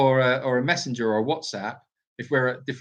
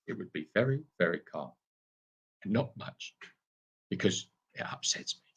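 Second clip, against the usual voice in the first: mock Michael Caine; 7 semitones lower, 9 dB quieter than usual. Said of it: "If I did do Michael Caine".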